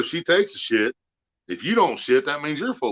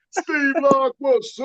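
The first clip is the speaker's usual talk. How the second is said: high, drawn-out shout-out; big stretched-out welcome, way up high; hollered greeting; 3 dB louder, slower than usual. Real, not acted. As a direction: holler hello to a buddy who just showed up. Calling out greeting to arrival.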